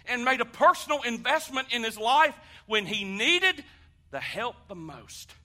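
loud, high preacher's build; full-throated sermon climax; preaching at full force. Acted. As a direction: drive the point home to the congregation.

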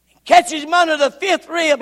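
normal talking voice

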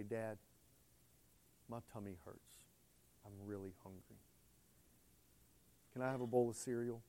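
put-on soft, hushed little boy asking for food; small child's plea. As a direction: plead quietly like a hungry child. Son pleading, voiced in story.

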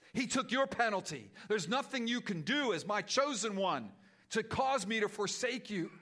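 loud, pleading prayer voice; fervent prayer. Acted. Pastor praying for the weary congregation, fervent and loud. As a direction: pray aloud over the church, pleading.

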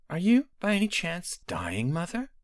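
lightly voiced shrewd daughter, lifted pitch; a hint of a sly princess asking carefully; Potema's voice. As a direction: daughter gently probing her dying mother. Potema questions her mother.

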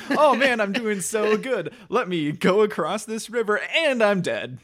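high, naive, hammed-up delight; mock cheerful gamer. Acting out cheerful doomed game player.

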